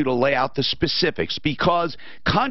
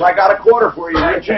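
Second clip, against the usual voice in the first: creepy uncle; 4 semitones higher, 9 dB louder, faster than usual. Retold or imitated. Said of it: "he says, he says".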